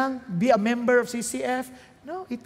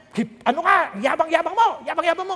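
higher, louder mock outburst; imitating angry friend; 7 semitones higher, 6 dB louder than usual. Retelling a friend angered by the gospel, mock angry. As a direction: fire off the retold accusation.

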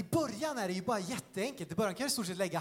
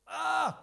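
sudden loud, high "Ah!"; mock scream; 8 semitones higher, 4 dB louder than usual. Shown as a demonstration. Preacher shows the devil screaming at you, mock scream. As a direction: let out a brief startling yell. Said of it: "står här och skriker till dig".

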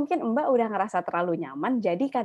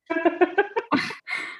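embarrassed laugh; 10 semitones higher than usual. Real, not acted. Laughing at own story.

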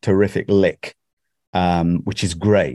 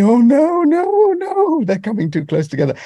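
mock dread. Self-conscious actor dreading the engineered scenes, mock dread. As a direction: wail in comic panic, pitch climbing and held. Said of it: "I'm thinking".

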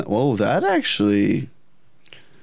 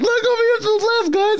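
very high, rushed mock yelling; imitated excited screaming; faster than usual. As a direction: yell excitedly like one of the gang. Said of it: "everyone's screaming at Pokemon".

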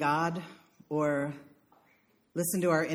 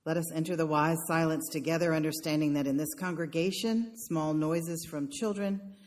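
guided meditation; 3 semitones lower, 4 dB quieter than usual. Acted. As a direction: ease them into stillness. Leads a meditation.

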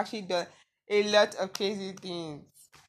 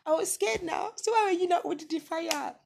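much higher, mock-whiny younger self; childish protest; 9 semitones higher than usual. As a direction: play yourself as a defensive kid. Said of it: "And I'm like".

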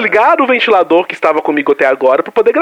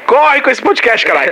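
yelling; 8 semitones higher than usual. Real, not acted. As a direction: shout at him to hurry. Yelling at partner to hurry.

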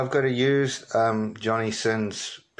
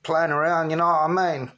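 Danny Dyer; 5 semitones higher than usual. Shown as a demonstration. Caller pushes on with the actor demo, raised geezer impression. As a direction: lift it into a British geezer voice. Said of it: "He kinda talks a little bit like this".

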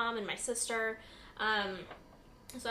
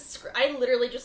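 ordinary talk, nothing marked

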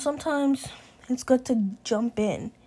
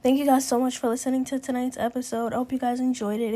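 usual speaking voice

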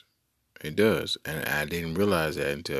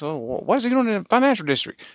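put-on higher, louder old newsroom editor; 1940s newsroom editor; 13 semitones higher, 5 dB louder, faster than usual. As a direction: play the fast-talking 1940s editor. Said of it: "the editor's gonna ask".

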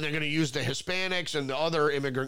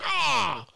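loud, higher shriek of revulsion; mock shriek; 7 semitones higher, 4 dB louder than usual. Brother hearing Trump's name, mock shriek. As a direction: yelp at the mere name. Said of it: "He's like".

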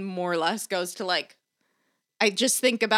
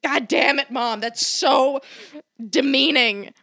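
raised, higher mock outburst; exasperated retort; 5 semitones higher, much slower than usual. Telling mom they are sketches, hammed-up exasperation. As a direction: play the indignant offspring correcting a parent.

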